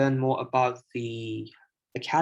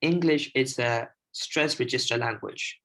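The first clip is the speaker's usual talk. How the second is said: ordinary talk, nothing marked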